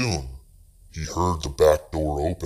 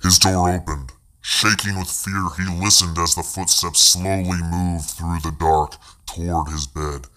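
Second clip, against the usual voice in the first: plain reading voice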